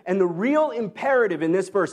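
ordinary delivery

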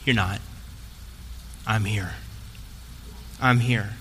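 low, soft comfort with long pauses; reassuring. Retold or imitated. God's Spirit to the lonely, quiet low reassurance. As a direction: drop low and let each short phrase settle.

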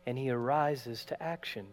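normal talking voice

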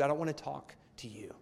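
put-on sulky whisper of a boy pushing his dad away; whispered sulk. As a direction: breathe it out, unvoiced and sulky.

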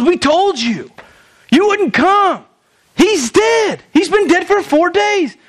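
high-pitched, exasperated acted outburst; acted reproach. Sisters telling Jesus he came too late, acted reproach.

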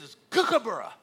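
KOOKABURRA said high and loud with short oo as in "book"; corrected pronunciation. Retold or imitated. Retold man correcting the American, emphatic short-vowel "kookaburra". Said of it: "He says".

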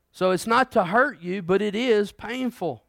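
ordinary delivery

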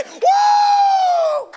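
very high, loud, sustained whoop; praise whoop. Shown as a demonstration. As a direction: let out a long ringing "woo" of praise. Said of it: "when you just reach down and you say".

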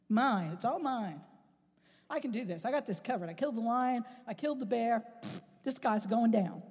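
low, quiet, self-assured boasting; a lightly voiced confident boy bragging to himself; cocky young David. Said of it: "But David said".

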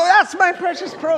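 high, loud indignant cry over the pearl; owner of the pearl. Retold or imitated. Pearl owner protesting to the pig, high and loud. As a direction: squeal it like your treasure was wronged. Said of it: "And you go".

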